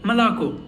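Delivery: "Malako" with reduced first vowels and a full final O; stress on last O. Shown as a demonstration. Teacher showing how milk sounds, final O stressed in "Malako". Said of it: "only last O is stressed".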